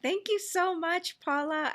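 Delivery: bursts up high with delight; excited thanks. Real, not acted. Host thanks guest warmly.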